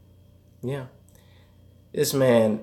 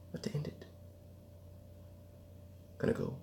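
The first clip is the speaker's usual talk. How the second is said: unvoiced whisper; 22 dB quieter, much faster than usual. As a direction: hush it right down. Whispered after shout.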